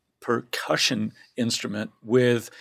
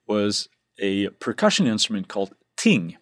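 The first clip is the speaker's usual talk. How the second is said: ordinary talk, nothing marked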